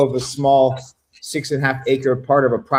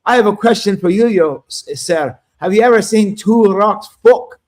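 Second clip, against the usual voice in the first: higher, louder put-on Scottish accent; playful Scottish brogue, asking the question like a heckler; 7 semitones higher, 4 dB louder than usual. Host retells trolling the Australian scientist, in a put-on Scottish accent. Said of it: "so I used a Scottish accent and I said".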